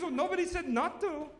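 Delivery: high put-on old lady voice; high innocent granny voice; mother-in-law voice.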